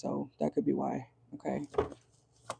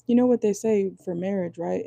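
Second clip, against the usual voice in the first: normal talking voice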